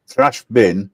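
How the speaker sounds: ordinary talk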